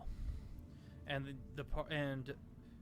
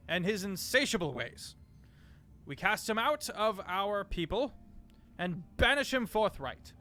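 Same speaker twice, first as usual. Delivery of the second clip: booming denunciation; 4 semitones higher, 6 dB louder than usual. Acted. Ambassador banishing Drogar before the council, booming. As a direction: proclaim the banishment with full force. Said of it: "voice becoming much more booming".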